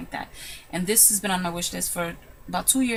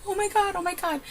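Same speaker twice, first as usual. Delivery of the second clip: retold high-pitched excited gush; trembling thrill; 11 semitones higher than usual. Winner reliving her thrill at winning, in high excitement. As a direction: replay the thrilled moment, pitch way up. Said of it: "I was trembling".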